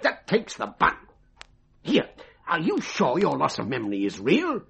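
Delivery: ordinary delivery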